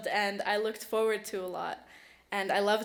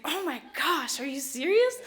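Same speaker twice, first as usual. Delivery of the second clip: raised-pitch, re-enacted surprise; incredulous, pitch lifted on the question; astonished disbelief; 6 semitones higher, 5 dB louder than usual. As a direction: replay your stunned reaction to good news. Retells her reaction to winning.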